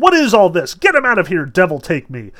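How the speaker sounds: high, loud storybook outburst; acted exasperated yell, falling at the end; angry shout. A narrator's reading. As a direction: shout it as a man out of patience. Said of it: "and he shouted".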